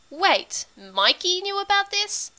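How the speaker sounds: high, rising disbelief; taken aback. A narrator's reading. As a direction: question it high, caught off guard. Character learns surprising news.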